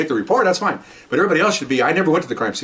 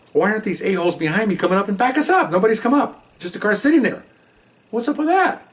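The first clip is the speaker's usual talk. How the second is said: higher, irritated disbelief at the missing backup; exasperated inner rant; 8 semitones higher than usual. Retold or imitated. Cop with shotgun fumes about missing backup, pitched up and exasperated. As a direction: fume that nobody is coming to help. Wondering why backup stays back.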